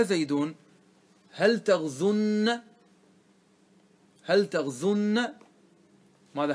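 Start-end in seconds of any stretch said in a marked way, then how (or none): 1.3-2.6 تغزن as taghzunna, long doubled n; emphatic nun. Shown as a demonstration. Teacher adds nun of emphasis, holding the nn in تغزن. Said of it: "ندخل بعد ذلك نون التوكيد". 4.2-5.3 تغزن as taghzunna, long doubled n; emphatic nun. Shown as a demonstration. Teacher adds nun of emphasis, holding the nn in تغزن. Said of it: "ندخل بعد ذلك نون التوكيد".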